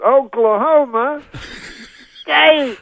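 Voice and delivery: slow, high, loud drawn-out call; Cosby teasing the crowd, slow shout. Retold or imitated. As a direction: stretch every syllable for the crowd. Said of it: "he says it slower".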